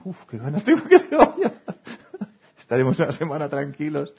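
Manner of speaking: gleeful about the boss leaving; relieved coworker laughing. Retold or imitated. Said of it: "estás esperando que alguien se vaya". (compañero aliviado riendo, mucho más agudo y fuerte)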